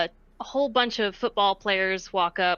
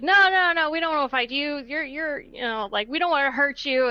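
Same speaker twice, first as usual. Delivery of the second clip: higher, hurried backing-off in mock reluctance; protesting refusal; 6 semitones higher than usual. Football players refusing to fight her, high-pitched mock protest. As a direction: imitate players anxiously begging off a fight.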